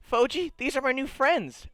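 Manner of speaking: high, bright introduction; proud guide. Acted. Guide introducing the party to the shopkeeper, high proud voice. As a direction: show off your new friends. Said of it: "he goes".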